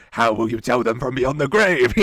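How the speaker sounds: loud, echoing supervillain voice; booming echoing villain monologue. Retold or imitated. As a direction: boom it out like a cartoon villain. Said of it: "supervillain voice".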